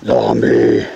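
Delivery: deep, low zombie groan. Shown as a demonstration. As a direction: growl the word like a zombie. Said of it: "Gotta make your zombie noises".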